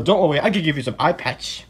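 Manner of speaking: usual speaking voice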